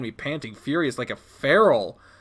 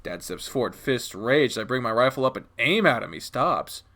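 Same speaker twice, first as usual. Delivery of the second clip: ordinary delivery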